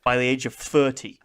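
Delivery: normal talking voice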